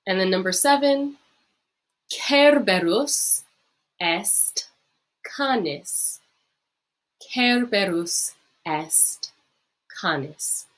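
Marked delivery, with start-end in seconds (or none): 2.1-10.7 slow, syllable by syllable, for the class to repeat; slow model reading. Shown as a demonstration. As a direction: read it out slowly so students can copy. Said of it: "practice saying it yourself".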